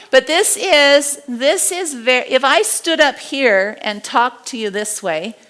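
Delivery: ordinary talk, nothing marked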